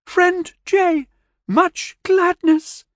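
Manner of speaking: put-on high, slow childlike alien; high, slow, happy alien greeting; alien creature voice. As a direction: lift it up high and stretch it out slowly like a gentle alien child. Alien Keent speaks broken English.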